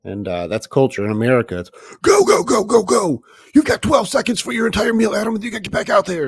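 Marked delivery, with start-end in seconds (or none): 2.0-6.3 high, loud coach barking orders; football coach yell. Retold or imitated. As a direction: shout like a line chef rushing staff. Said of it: "football coach, you know, line chef".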